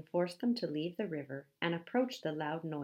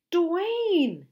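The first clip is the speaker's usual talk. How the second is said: high, very loud drawn-out cry; panicked holler; fearful shout; 12 semitones higher, 11 dB louder than usual. A narrator's reading. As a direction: yell the name in alarm. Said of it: "Handsome shouted, fearing for the worst".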